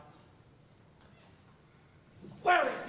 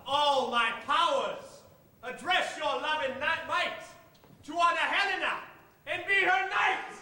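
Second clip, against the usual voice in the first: building to a loud high cry; rising vow; 5 semitones higher, 6 dB louder than usual. Acted. Lysander vowing himself to Helena, building to a loud cry. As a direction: swell up into a proud oath.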